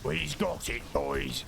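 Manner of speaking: gruff brutish orc voice. Pretend. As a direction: bark it out like a warband orc. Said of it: "The orc voice".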